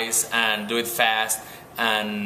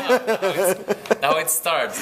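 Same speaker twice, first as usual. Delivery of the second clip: laughing; 9 semitones higher, slower than usual. Real, not acted. Asked to redo answer, laughing.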